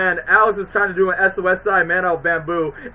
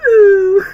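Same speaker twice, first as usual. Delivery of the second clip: high, loud whiny wail; mock cry; 14 semitones higher, 10 dB louder than usual. Mort cries out, mock whiny wail. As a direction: wail like a hurt child. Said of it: "Mort just cries".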